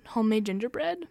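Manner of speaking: normal talking voice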